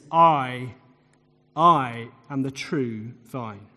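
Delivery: slow down and land on "I" twice; solemn, low emphasis on "I"; stress on I. Shown as a demonstration. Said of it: "put the emphasis on him".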